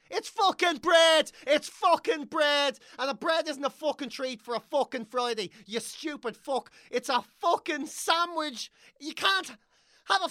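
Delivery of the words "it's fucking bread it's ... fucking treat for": high, loud and slow outraged shout; furious rant. Real, not acted. Comedian raging at the shop woman's comment, loud outraged shout. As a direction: bellow it in disbelief.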